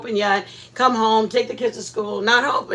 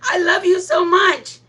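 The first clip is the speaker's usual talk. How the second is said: high, tender, half-laughing coo; doting baby talk; 7 semitones higher than usual. Retold or imitated. Talking to her lilies, high doting coo. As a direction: coo to the flowers like babies. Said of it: "the one that I always say".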